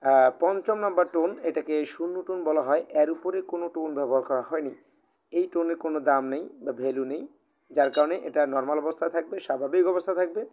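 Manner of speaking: usual speaking voice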